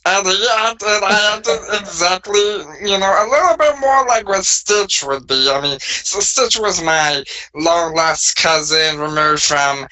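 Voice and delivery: high alien creature voice; squeaky Stitch-like voice; Bob voice. Acted. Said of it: "this was his voice".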